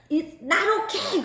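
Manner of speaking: high, indignant retort; retold angry outburst. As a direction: snap back angrily, pitch jumping up. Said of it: "제가 너무 화가 나서".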